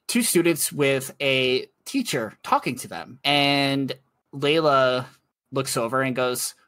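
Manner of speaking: normal talking voice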